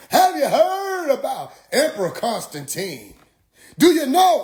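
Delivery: high, stretched mock-preacher quizzing; imitated street preacher. Preacher dodging with church history, high mock grandeur.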